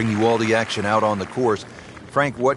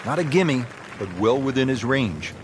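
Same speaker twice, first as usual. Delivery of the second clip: golf commentator. Acted. Game commentary play-by-play.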